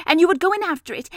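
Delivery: high, loud, quick run-on; eager Piglet. A narrator's reading. Piglet hurrying through the trap plan, quick and high.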